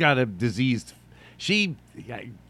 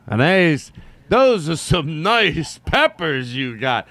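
slow, louder creep line with a chuckle; sleazy pickup line; 4 semitones higher, 5 dB louder, much slower than usual. Retold or imitated. Old guy's pickup line to the ladies, slow and hammy. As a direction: lay on the cheesy charm. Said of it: "I used to always say that to the ladies".

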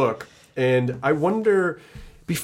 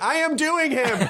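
high, slow, emphatic cartoon voice; Craig; 14 semitones higher, slower than usual. Answering kids who ask for the character, high cartoon voice. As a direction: push your own voice up, big and insistent. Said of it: "Do Craig".

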